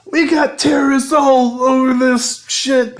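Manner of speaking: mock John voice. To the radio lady, a high put-on hero voice. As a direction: pitch it way up as a dopey hero. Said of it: "And he's like".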